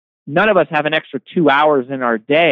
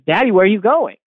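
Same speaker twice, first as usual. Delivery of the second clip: lightly voiced little girl, higher; a small child asking her daddy, pitched up; toddler's question; 7 semitones higher than usual. Dad retelling his toddler's question.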